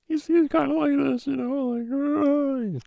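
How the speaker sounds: squeaky, high terrified voice; mock panicked high-pitched whimper; scared guy impression. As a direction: squeal it like a man scared for his life. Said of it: "he's kinda scared for his life".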